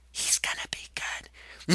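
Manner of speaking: slow, secretive whisper. Acted. The ghost whispering a tip into Max's ear. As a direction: hiss it like a secret temptation.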